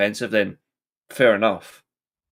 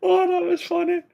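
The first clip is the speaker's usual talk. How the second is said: very high squeal of amusement; high-pitched, helpless with laughter; laughing; 3 dB louder than usual. Real, not acted. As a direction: squeeze the words out through laughter. Recovering from laughing fit.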